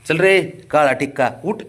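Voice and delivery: drawn-out loud call to the dog; slow loud call. Real, not acted. Calling the dog to come. (कुत्र्याला मोठ्याने, सावकाश हाक)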